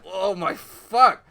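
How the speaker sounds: slow, loud mock daze; dazed pigeon. Pigeon laid out on the floor, slow mock daze. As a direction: drag it out like a knocked-out bird. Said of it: "pigeon's fucking laid on the floor like".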